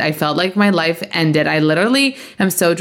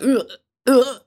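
retching; 8 semitones higher than usual. Retold or imitated. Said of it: "I see him like".

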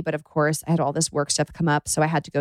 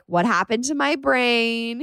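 high, slow, drawn-out cutesy imitation; Max; 6 semitones higher, slower than usual. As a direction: imitate a partner's cooing question, pitched up. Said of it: "he's like".

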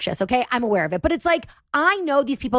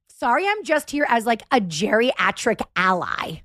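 normal talking voice

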